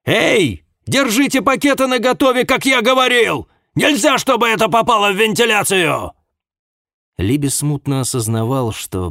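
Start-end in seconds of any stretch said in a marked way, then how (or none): 0.0-6.1 high, loud urgent shout. A narrator's reading. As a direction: yell the warning across the room. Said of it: "он услышал крик Маккоя".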